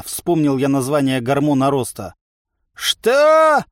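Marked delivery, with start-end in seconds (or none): 2.7-3.6 loud, very high outraged yell; stretched, affronted shout; indignant. A narrator's reading. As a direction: bellow in offended disbelief. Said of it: "возмутился здоровяк".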